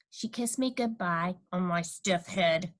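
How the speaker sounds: plain reading voice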